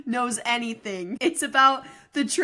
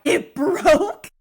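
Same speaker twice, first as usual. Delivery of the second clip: shocked question; 6 semitones higher, 7 dB louder than usual. Retold or imitated. Beth shocked the condom broke.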